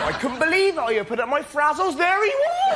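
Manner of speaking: excited voice climbing to a high cry; mock amazement. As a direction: build in disbelief to a high shout. Acted crisp finder amazed by Jesus.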